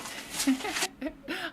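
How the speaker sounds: short high laugh; a real burst of laughter. Real, not acted. Laughing at a funny donation.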